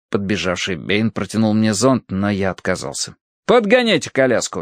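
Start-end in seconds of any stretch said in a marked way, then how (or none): 3.4-4.6 brisk, higher-pitched command; giving an order. A narrator's reading. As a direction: order it done. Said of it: "велел я". (повелительно, повыше голосом)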